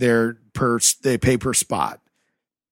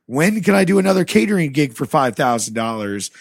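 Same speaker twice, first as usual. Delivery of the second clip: louder, higher, sarcastic rant at the boss; angry mock phone call; 5 semitones higher, 5 dB louder than usual. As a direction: snap back sarcastically as if on the phone.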